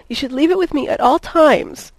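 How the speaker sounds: high, loud, plaintive whimper; pleading whine with emphatic peaks. A narrator's reading. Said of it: "she whimpered".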